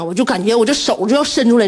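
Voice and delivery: ordinary talk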